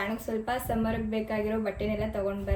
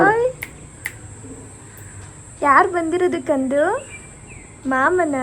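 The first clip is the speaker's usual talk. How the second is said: high, loud sing-song questions; 6 semitones higher, 4 dB louder than usual. Real, not acted. Mother cooing at baby.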